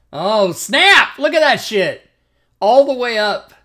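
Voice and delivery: loud, high, thrilled yell; excited shout. Real, not acted. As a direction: cheer the big roll. Big damage roll.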